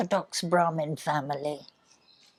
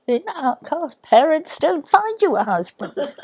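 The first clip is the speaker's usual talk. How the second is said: high-pitched mock self-pity, louder on the refusal; 10 semitones higher, 6 dB louder than usual. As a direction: imitate a sorry-for-herself teenager protesting. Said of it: "I was feeling sorry for myself".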